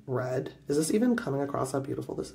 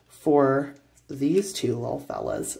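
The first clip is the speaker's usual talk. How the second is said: ordinary talk, nothing marked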